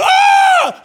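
high, loud mock scream of a kid; shrill imitated yelp at broccoli; imitated child scream. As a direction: shriek like a child forced to eat broccoli. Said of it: "he screamed the other day".

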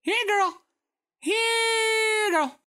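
very high, drawn-out dog call. Retold or imitated. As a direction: coax the dog over in a sing-song voice. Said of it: "Maybe someone actually does call".

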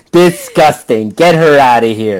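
loud, slow, hammed-up disgust; mock disgust. Joking to co-host about the actress, hammed-up disgust. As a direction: ham up the disgust, laughing through it.

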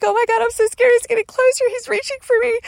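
very high, loud frantic squeal; high-pitched cry of a scared child; terrified reliving. Retold or imitated. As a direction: panic as the shadow closes in. Reliving terror of approaching shadow.

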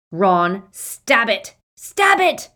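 loud high desperate yell. Acted. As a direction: yell the order. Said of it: "Harry yelled".

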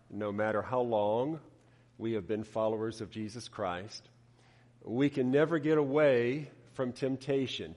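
usual speaking voice

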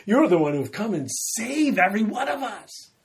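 bold declaration. Retold or imitated. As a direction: announce it boldly as Peter. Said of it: "Peter boldly says".

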